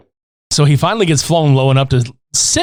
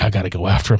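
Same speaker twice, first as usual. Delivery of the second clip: low hero line; 11 semitones lower, 4 dB quieter, much faster than usual. Retold or imitated. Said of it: "He's like".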